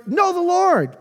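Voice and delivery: high exclaim. Acted. Quoting neighbours in scripture reading.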